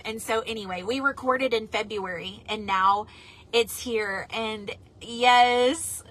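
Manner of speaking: ordinary talk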